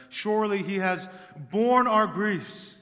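thundering pulpit reading; full-force preaching. Acted. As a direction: preach Isaiah out to the whole hall.

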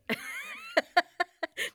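high, loud burst of laughter; real laugh. Real, not acted. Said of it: "I'm laughing".

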